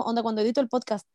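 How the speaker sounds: ordinary talk, nothing marked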